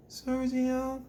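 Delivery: high, small, soft Sergio; weak boy reply. Retold or imitated. Imitating the boy saying Sergio timidly. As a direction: say it like a shy boy. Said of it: "they talk like that".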